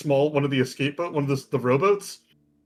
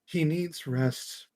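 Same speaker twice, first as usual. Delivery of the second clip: soft, slow, soothing character voice; Lorius; 3 semitones lower, 8 dB quieter, slower than usual. Acted. Lorius excusing the exhausted Cray, soft and slow. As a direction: let it sink low and tender. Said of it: "Lorius is gonna come over and go".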